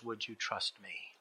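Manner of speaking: very soft, low voice; gentle appeal. Acted. God asks the dangling believer for trust, quietly. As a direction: ask it quietly and tenderly.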